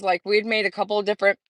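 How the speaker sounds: ordinary delivery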